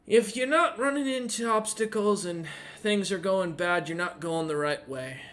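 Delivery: put-on high, eager boy's voice; a kid pleading his case to the hero, in a high earnest voice; earnest young kid.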